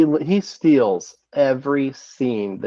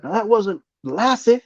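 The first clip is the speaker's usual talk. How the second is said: lightly acted, high, drawn-out movie line; a hint of comic impersonation; quoted comic quip; 8 semitones higher, slower than usual. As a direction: stretch the punchline up high, slowly. Quoting actor's comic line from film.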